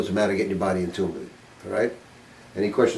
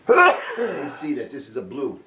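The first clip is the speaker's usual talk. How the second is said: slow, laughing through the words; amused laughing; 5 semitones higher, slower than usual. Real, not acted. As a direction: chuckle as you spell it out. Amused point about the painter's eye.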